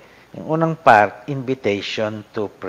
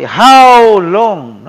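"How long" high and loud, pleading; lament cry; 9 semitones higher, 13 dB louder than usual. Shown as a demonstration.

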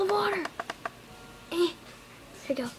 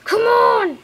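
much higher and louder shout; excited yell; 11 semitones higher, 14 dB louder than usual. Real, not acted. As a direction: yell it at the screen. Yelling at battle.

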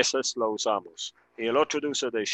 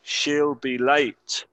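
very slow model; 3 semitones higher, 4 dB louder, much slower than usual. Shown as a demonstration. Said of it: "escuchar la pronunciación".

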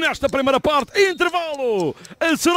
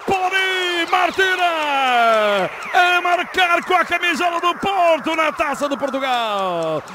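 excited goal commentary; slower than usual. Acted. Replay after the goal.

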